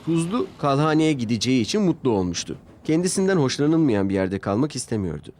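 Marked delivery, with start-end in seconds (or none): none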